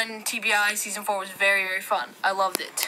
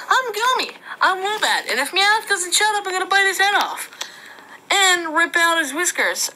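squeaky toy threat; 6 semitones higher, 4 dB louder than usual. Pretend. Voicing toy threatening Meowth.

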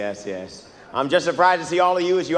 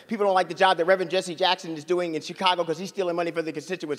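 ordinary talk, nothing marked

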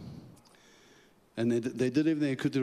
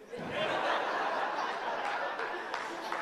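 quiet breathy laugh; 4 dB quieter than usual. Real, not acted. Laughing at himself hiding.

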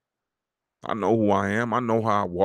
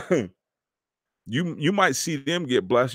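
ordinary talk

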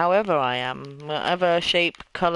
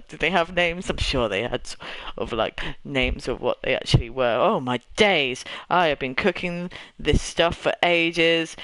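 usual speaking voice

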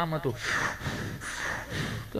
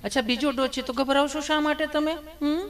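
higher, sugary nurse voice; teasing nurse; 7 semitones higher than usual. Retold or imitated. Nurse teasing scared Gabho, higher sugary voice. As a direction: coo at him like a fussing nurse. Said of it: "nurse બેને પૂછ્યું".

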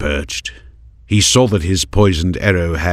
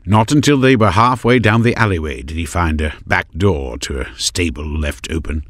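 usual speaking voice

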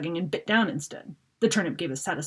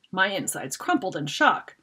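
plain reading voice

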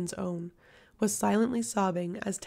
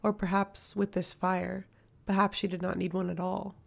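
ordinary delivery, nothing marked

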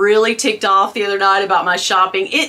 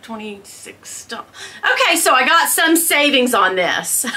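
ordinary talk, nothing marked

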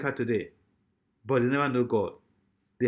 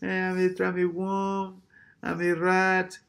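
mock humble prayer; 2 semitones higher, 3 dB quieter, slower than usual. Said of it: "what they are praying".

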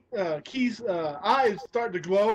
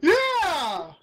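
higher, louder, drawn-out yeah; gleeful yes; 7 semitones higher, 5 dB louder than usual. Real, not acted. Co-host thrilled his friend remembers the joke, gleeful. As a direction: burst out in delighted agreement.

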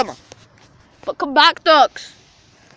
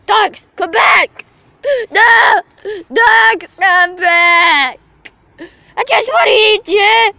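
wailing shout after someone getting away, higher and loud; mock desperate shouting; 5 semitones higher, 5 dB louder, much slower than usual. Vlogger calling the ducks back, hammed-up wailing shout. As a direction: cry out in hammed-up despair.

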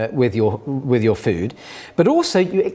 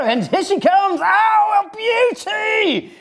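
excited cheer; much slower than usual. Shown as a demonstration. Presenter celebrating the model poo, high and drawn out. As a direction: greet it like a triumph.

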